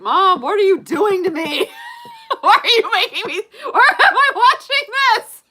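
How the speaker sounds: high, shouted mock wail through laughter; hammed-up distraught child, shrieking and laughing; horrified little kid. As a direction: wail at Mom, cracking up. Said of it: "I was just like".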